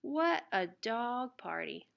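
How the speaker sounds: plain reading voice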